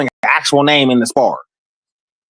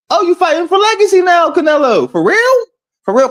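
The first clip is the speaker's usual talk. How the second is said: high, mocking sing-song question; sarcastic high-pitched taunt; mocking disbelief; 10 semitones higher than usual. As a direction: needle him like you don't buy it. Mocking Canelo's new claim.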